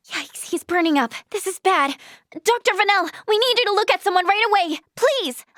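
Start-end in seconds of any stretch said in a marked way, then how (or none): 2.3-5.5 high, pressing cry for help; alarmed, higher-pitched appeal; urgent plea. Acted. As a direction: beg the doctor to hurry, pitch raised. Urgent plea for a sick patient.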